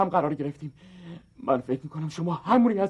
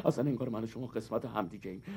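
lower, softer, dreamy romancing; mock tender; 9 semitones lower, 11 dB quieter than usual. Suitor woos the woman, lower and softer. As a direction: woo her softly.

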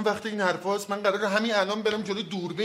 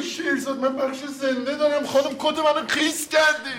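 higher, slow scolding shout; angry shout; 7 semitones higher, slower than usual. Acted. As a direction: slowly yell out the complaint. Jacket soaked before live show.

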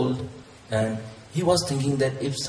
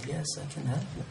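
quiet divine voice; 9 dB quieter, faster than usual. Acted. God answering the hanging climber, softly. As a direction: answer gently, barely above a whisper. God's voice in story.

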